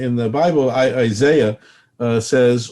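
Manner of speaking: ordinary talk, nothing marked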